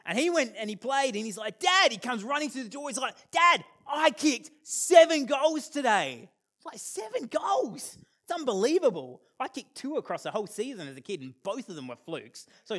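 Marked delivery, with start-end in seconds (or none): 1.6-1.9 high, loud call from the doorway; excited call. Retold or imitated. Son running through the door, loud excited call. 3.3-6.3 high, loud and slow, bursting with pride; excited shout. Retold or imitated. Son telling dad his goals, loud excited shout. 6.8-9.2 amazed. Retold or imitated. Dad reacting to son's claim, high amazed.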